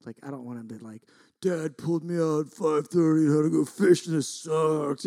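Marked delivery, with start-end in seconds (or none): 1.4-5.0 mock kid's grumble, somewhat louder; mock complaining. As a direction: imitate a son griping about a wasted morning. Voices son's imagined complaint.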